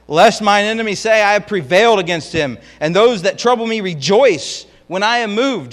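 fiery pulpit reading of the lament; preaching at full force. Acted. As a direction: proclaim the psalm like a cry to heaven. Preacher reads psalm at full force.

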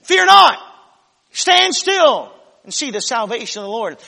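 high, loud, sweeping declaration; hammed-up picture-book Moses booming over the sea; mock grand proclamation. Preacher mocking the picture-book Moses with a grand loud cry. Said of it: "They always show Moses standing there with his rod outstretched".

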